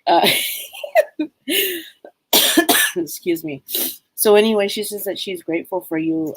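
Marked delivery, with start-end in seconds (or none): 0.3-1.8 high-pitched laugh to herself. Real, not acted. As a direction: break into a high little laugh. Laughs while gathering herself.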